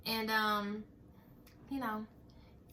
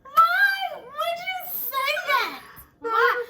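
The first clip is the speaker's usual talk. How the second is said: high, loud, very slow drawn-out why; incredulous shout; 9 dB louder, much slower than usual. Retold or imitated. At the ex who confessed to the officer, drawn-out disbelieving shout. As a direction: howl it in disbelief.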